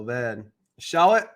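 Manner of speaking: ordinary talk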